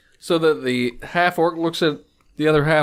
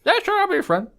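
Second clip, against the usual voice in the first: eager agreement; 12 semitones higher, much faster than usual. Acted. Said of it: "half-orc agrees eagerly".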